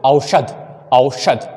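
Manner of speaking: औषध as au-shadh, sh and breathed dh; correct pronunciation. Shown as a demonstration. Teacher gives children the right word, औषध as au-shadh. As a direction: open औषध on au, then shadh. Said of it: "तो सही शब्द है".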